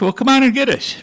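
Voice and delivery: high, loud taunt; defiant shout. Retold or imitated. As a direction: dare them to come. Defiant reply to enemy threat.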